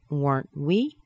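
"Weren't we" rising steeply at the end; rising intonation. Shown as a demonstration. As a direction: lift "we" as a question. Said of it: "Going up in intonation".

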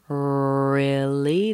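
"really" with a long drawn-out R; held R. Shown as a demonstration. Teacher shows tongue back then forward, long R. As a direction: stretch the opening R of "really" before LEE. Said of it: "the tongue's coming back".

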